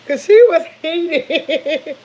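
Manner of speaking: slow words through real laughter; laughing about the heated friend. Real, not acted. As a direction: laugh it out slowly. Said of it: "laughing while telling".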